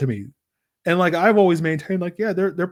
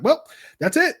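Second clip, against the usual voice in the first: high mock-triumphant voice; mock fans who think it's all solved; sarcastic; 13 semitones higher than usual. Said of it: "It's like".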